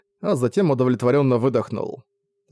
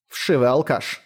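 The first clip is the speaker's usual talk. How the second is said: grumbling; slower than usual. A narrator's reading. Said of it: "проворчала Делайла".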